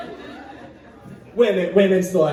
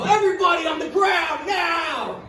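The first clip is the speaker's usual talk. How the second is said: robber's shout; 8 semitones higher than usual. Pretend. Bank robber ordering hostages down, hammed-up yell. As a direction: bark the order at the room. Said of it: "have guns and go".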